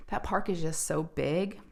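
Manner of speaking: ordinary talk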